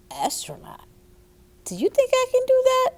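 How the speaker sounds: put-on high, fast, amazed child's question; surprised little girl. Little May asking her mother if she can, high and amazed. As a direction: gasp of hope, then rush the question.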